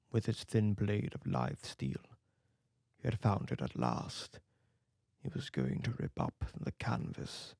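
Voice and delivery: plain reading voice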